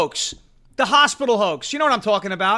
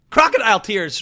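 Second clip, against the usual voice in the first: usual speaking voice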